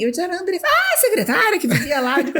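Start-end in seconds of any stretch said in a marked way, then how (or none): 0.2-0.7 higher, lightly acted; bright self-introduction, pitched up; quoting herself. To colleague who asks, a touch higher. Telling colleague her name. 0.7-2.2 higher, with a laugh; laughing as the penny drops; amused recognition. Retold or imitated. Colleague recognizing the secretary, laughing.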